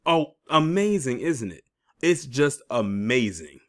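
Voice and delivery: slow, drawn-out mock admiration; sarcastic. Real, not acted. Commentator mocking the payout news to viewers, heavily sarcastic. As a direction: stretch out "amazing" with heavy sarcasm. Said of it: "saying this in the most sarcastic tone".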